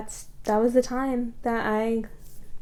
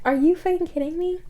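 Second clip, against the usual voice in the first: incredulous; 8 semitones higher, faster than usual. Retold or imitated. Retelling her outraged reply.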